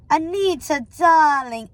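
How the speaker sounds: effusive, drawn-out endearment; theatrical, swelling hello to a friend; gushing greeting. Acted. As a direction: greet with overdone affection.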